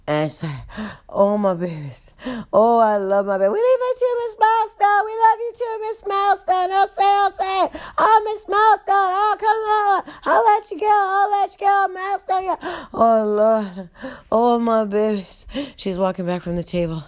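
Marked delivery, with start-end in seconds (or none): 1.1-3.5 lightly acted winded old woman; catching breath. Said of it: "she is out of breath". 3.5-12.9 high adoring crowd squeals; screaming inmates. Acted. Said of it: "everybody's screaming and yelling". 12.9-15.5 put-on higher, drawn-out winded old woman; out of breath. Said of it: "she is out of breath".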